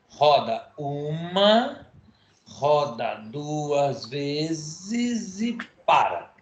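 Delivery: slow, drawn-out demo of each movement; bem devagarzinho. Shown as a demonstration. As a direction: stretch every word for the kids to follow. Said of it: "eu vou fazer bem devagarzinho". (devagarzinho, bem lento)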